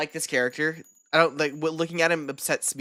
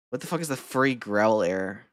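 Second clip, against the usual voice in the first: ordinary delivery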